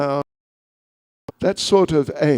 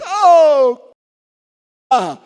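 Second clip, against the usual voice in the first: exclaiming; 9 semitones higher, 7 dB louder than usual. Retold or imitated. Lady exclaims over crying child.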